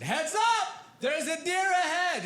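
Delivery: high, urgent warning call. Retold or imitated. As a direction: shout a warning to the driver. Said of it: "I said".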